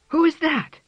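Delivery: high, loud startled question; jolted awake, cry out. Acted. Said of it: "cried Missus Tabby, startled".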